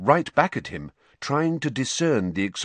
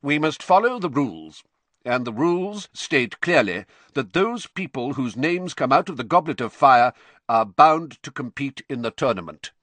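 curt ruling, somewhat raised pitch; 5 semitones higher, 4 dB louder than usual. A narrator's reading. Crouch ruling on the tournament, curt. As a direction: lay down the rules curtly. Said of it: "his usual curt voice".

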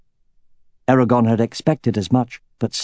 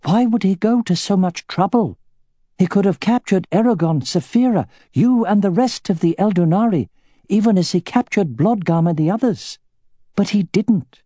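higher, lighter voice for the elf warrior; elf woman's voice; 10 semitones higher than usual. Acted. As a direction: voice the elf woman, steady and sharp. Arya voiced by the narrator.

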